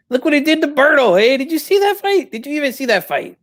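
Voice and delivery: high-pitched, incredulous questioning; indignant challenge. Pretend. Indignantly defending his son.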